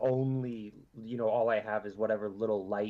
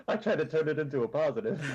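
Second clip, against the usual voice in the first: higher, laughing through the words; 5 semitones higher than usual. Real, not acted. Player joking to the table about a bad roll, laughing.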